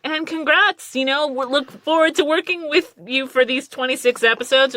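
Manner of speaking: high, upbeat welcome; imitating a cheery studio welcome; mock congratulations. Studio welcoming surprised lead actor.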